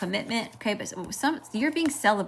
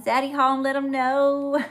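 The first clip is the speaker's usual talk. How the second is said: raised, drawn-out mock cat boast; voicing the cat; 7 semitones higher, 4 dB louder, slower than usual. Reader voices her alpha cat for viewers, high playful drawl. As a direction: speak up high as the cat, stretching the end. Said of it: "He says".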